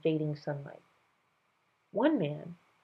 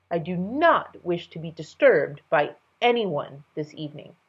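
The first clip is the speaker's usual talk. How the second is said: commanding king; 4 semitones higher, 5 dB louder than usual. Acted. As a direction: lay down the order.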